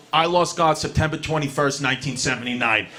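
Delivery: low and sinking to a quiet rumble; grim dad voice. Retold or imitated. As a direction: drop it heavy and dark. Dad's grim prison confession.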